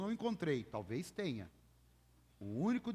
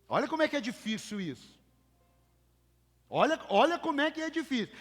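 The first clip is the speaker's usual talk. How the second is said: rising from loud to a much higher, louder cry; building exclamation; 10 semitones higher, 9 dB louder, faster than usual. Real, not acted. Preacher stressing to the church how hard it is, building. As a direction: preach it harder the second time.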